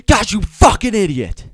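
high, loud acted tirade; yelling at himself. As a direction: erupt in self-directed fury. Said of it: "he was yelling at himself".

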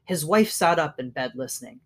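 usual speaking voice

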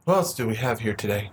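deliberate ASMR-style delivery; put-on ASMR podcast voice. Shown as a demonstration. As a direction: ask it in a low, smooth show-host voice. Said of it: "Don't do the whole podcast voice".

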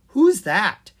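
loud, high curious question; eager question. Acted. Zed spotting the monkey on the bed, loud and eager. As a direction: blurt it out, delighted.

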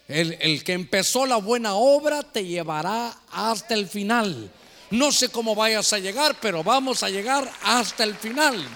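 loud, emphatic sermon delivery; impassioned preaching. Real, not acted. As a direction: preach it forcefully to the congregation. Preacher at full force. (predicación enérgica y apasionada)

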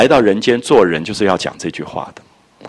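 normal talking voice

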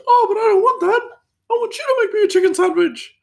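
loud, insistent mock falsetto; really high-pitched voice. Front-of-house guy insisting chef make his sandwich, high-pitched voice. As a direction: whine it very high, imitating a posh coworker. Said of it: "he just had this really high-pitched voice".

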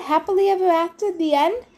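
high, quick hopeful question; cheery guess. A narrator's reading. Hero tries to wrap up god's story, cheerful.

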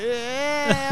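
put-on high squeaky little-kid yeah; hammed-up little boy. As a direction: squeak it like a seven-year-old. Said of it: "this seven-year-old kid".

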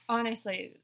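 ordinary delivery

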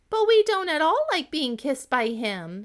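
ladies-in-waiting protesting to the princess. A narrator's reading. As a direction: whine it out as the reluctant ladies.

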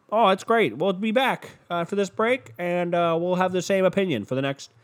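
hammed-up throw to a commercial break; pregame show host. Host sending the pregame show to break, mock-cheery. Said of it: "that pregame show, could you just imagine".

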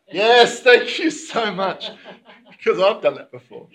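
laughing through a confession. Real, not acted. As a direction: giggle while owning up. Laughing at own habit.

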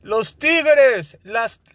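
high, loud, slow reggaeton hype call; [name] intro. Retold or imitated. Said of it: "las rolitas de [name]".

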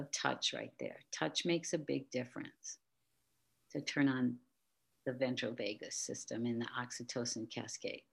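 soft, hushed guiding voice; guided meditation. Acted. Guiding listener with hand on heart, soft meditation voice. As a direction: gently lead a calming exercise, leaving pauses. Leads a calming touch exercise.

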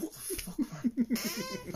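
laughed out half-voiced; can't keep it in while saying it; laughing disbelief. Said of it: "I was like".

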